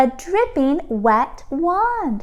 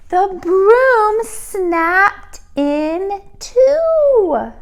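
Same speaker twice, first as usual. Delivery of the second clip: very slow, higher and louder; dramatic slowdown; 7 semitones higher, 5 dB louder, much slower than usual. A narrator's reading. Narrator reaching the broom snapping, very slow. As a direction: stretch each word out.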